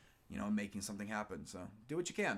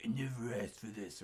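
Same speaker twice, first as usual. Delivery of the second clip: Adam Jensen line. Retold or imitated. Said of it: "Adam Jensen just saying things like".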